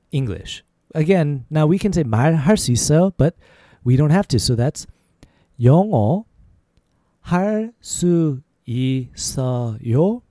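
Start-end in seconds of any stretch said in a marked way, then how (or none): 5.6-10.2 every syllable held and set apart; slow demonstration. Shown as a demonstration. Teacher showing learners how to ask about English, slow. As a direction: spell it out syllable by syllable. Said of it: "A little faster".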